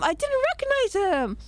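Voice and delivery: ordinary talk, nothing marked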